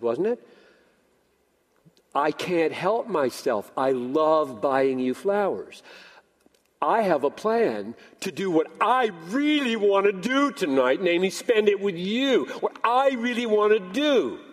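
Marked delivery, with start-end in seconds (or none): 2.1-5.8 slow, savoured declaration; deliberate joy statement. Acted. Husband explaining flowers to wife, slow and heartfelt. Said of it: "a totally my joy statement". 6.8-14.4 "I really want to do" higher and punched; self-delighting emphasis. Acted. Husband telling wife his plan, higher, emphatic on "I really want". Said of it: "upset at my selfishness".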